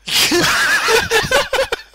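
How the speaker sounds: loud high burst of laughter. Real, not acted. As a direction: howl with laughter.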